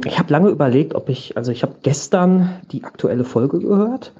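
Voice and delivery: ordinary talk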